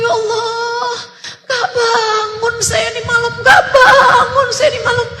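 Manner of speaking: high, tearful plea for forgiveness, voice breaking; woman weeping as she begs Allah's forgiveness; sobbing prayer. Retold or imitated. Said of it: "Beliau nangis terisak-isak".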